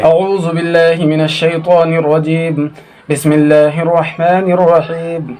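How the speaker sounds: measured, chanted Quranic opening; Arabic recitation. Real, not acted. Teacher opening the lesson with sacred words, in Arabic recitation. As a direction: recite with elongated vowels.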